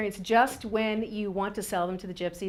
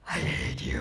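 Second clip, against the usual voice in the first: put-on much softer, breathy kid whisper; spiteful whisper; 8 dB quieter than usual. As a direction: hiss it just loud enough to be heard. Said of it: "just loud enough for both of us to hear".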